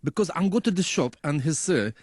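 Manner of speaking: lightly voiced Middle Eastern shopkeeper; Middle Eastern accent. Shown as a demonstration. Explaining the shopkeeper voice on request, Middle Eastern accent. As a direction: talk in halting English with Middle Eastern accent. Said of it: "he's the shopkeeper what has lived in London".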